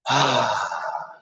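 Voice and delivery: loud releasing demo sigh; dramatic sigh. Shown as a demonstration. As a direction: let it all out audibly. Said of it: "a loud, audible, releasing sigh".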